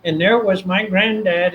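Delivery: ordinary delivery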